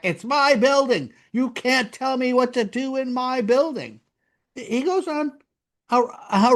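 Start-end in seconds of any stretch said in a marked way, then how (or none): 0.0-4.0 high-pitched, indignant mock yell; acting out a furious comeback; retold enraged yell. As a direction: yell back as if thoroughly enraged. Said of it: "yelled back".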